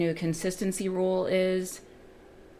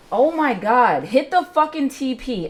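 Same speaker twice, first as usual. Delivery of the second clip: loud, higher, pleading at the screen; frustrated yell; 7 semitones higher, 8 dB louder than usual. Real, not acted. Trader pleading with price to hit target, loud yell. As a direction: shout at the chart in real frustration.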